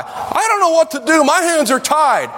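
high mock wail of helplessness; mock helpless wail. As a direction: throw up your hands and wail it. Mocking excuse, shouted.

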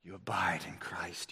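low, near-whispered repeat; soft, low, hushed delivery; hushed emphasis. Real, not acted. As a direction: drop almost to a whisper for weight. Hushed repeat of key line.

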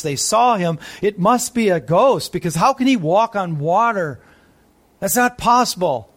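normal talking voice

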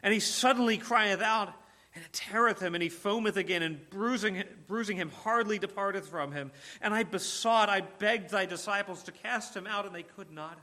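desperate father. Acted.

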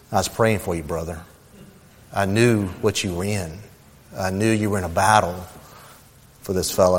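soft, low confiding voice; quiet reassurance. Retold or imitated. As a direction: gently confide support to a brother. Kind stranger quietly encourages him.

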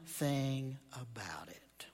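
slow, hushed confiding whisper; secretive slow whisper to the congregation. Real, not acted. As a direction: lean in and whisper the turn. Preacher turns to his point.

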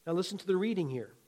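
ordinary delivery

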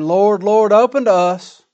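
loud, high, slow cry to be let in; pleading at the door. Retold or imitated. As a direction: shout through a shut door, begging. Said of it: "knock on the door".

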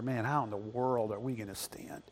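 anxious whisper; whispered worry. Retold or imitated. Church people quietly wondering how they will stand. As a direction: breathe it like a fearful doubt. Worried congregation's inner question.

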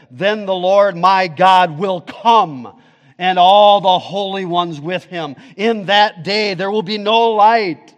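loud, slow preaching of scripture. Acted. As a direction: proclaim it to the whole hall.

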